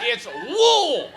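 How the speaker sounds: high, loud howl on "Wool"; mock angry crowd insisting; hammed-up irate yell, vowel stretched; angry correction. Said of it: "they, they were angry".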